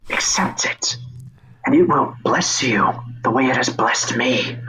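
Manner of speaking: hushed whisper, persuasive; whispering figure. Acted. Figure whispering to the struggling boy, persuasive.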